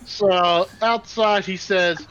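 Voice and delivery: normal talking voice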